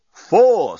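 talking bomb. Acted. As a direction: call the number like a bomb. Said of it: "said the bomb".

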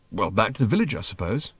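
plain reading voice

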